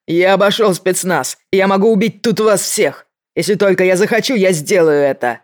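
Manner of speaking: loud, high unhinged threat; menacing shout. A narrator's reading. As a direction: rant like a cornered madman. Mankuza threatens to blow them up.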